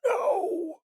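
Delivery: a high, strained mock rasp; a lightly done raspy ghost voice; raspy male voice. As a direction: imitate the raspy ghost saying it. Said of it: "a raspy male's voice go".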